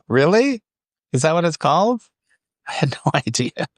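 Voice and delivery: real surprise, voice shooting up high; surprised disbelief. Real, not acted. On hearing the name dead bugs, genuinely amazed. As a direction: pitch jumps way up in amazed disbelief.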